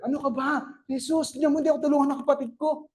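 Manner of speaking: high, fast complaining sister; Martha complaining. Pretend. Martha complaining to Jesus about her sister, high and fast. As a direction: whine it quickly to Jesus.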